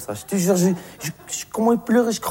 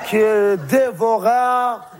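slow, ominous repeat; drawn out, very slow; much slower than usual. Shown as a demonstration. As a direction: stretch each syllable of qu'il dévora out. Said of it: "repeated even slower".

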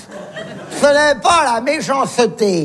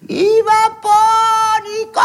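long, high distant holler; voice from above; 9 semitones higher than usual. Retold or imitated. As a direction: call down from far overhead, stretching the vowels. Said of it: "une voix de là-haut".

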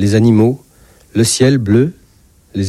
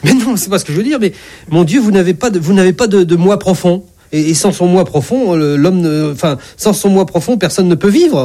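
high, halting protest; flustered doctor; 6 semitones higher than usual. Acted. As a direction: protest high, stumbling over the explanation. Doctor flustered, objecting to God.

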